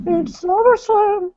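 piercingly high, wailing manager impression; Paul Bearer. Manager hyping the Undertaker to fans, high theatrical wail. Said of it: "How's my Paul Bear impression".

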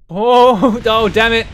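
loud, high cry of frustration; real dismay. Real, not acted. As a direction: cry out as it crashes. Craft crashes.

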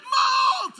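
high, loud mock yell; coach shouting a name. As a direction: call the name across the court. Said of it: "big fat coach".

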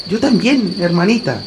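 put-on high, frightened young boy. A narrator's reading. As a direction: admit it as a scared boy. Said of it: "reconoció el muchacho".

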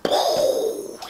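breathy unvoiced poof for a sudden landing; voiceless sound effect; whispered poof. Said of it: "Superman's like".

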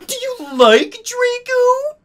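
very high, loud, drawn-out tease; squealing friend. Acted. Friend prying about Ebony's crush, high squeal. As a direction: squeal it slowly, milking the question.

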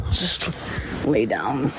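"lay down" drawn out slowly. Real, not acted. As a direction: stretch out "Just lay down". Repeated plea slowed.

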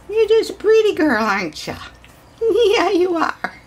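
very high coo at a puppy. Real, not acted.

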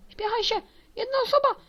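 high, loud, rising panic; frantic urging. Real, not acted. Urging friend to join before server fills.

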